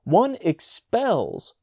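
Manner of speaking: slow high indignant exclaim. A narrator's reading. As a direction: proclaim it scandalised. Brownell scandalised, repeats.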